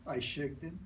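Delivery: ordinary delivery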